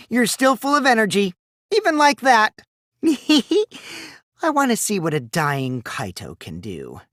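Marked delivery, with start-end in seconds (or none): 3.0-3.6 high, rising giggle; a high mocking giggle at the beaten hero; mocking laugh. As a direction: giggle with cruel delight. Villain laughing at Kaito.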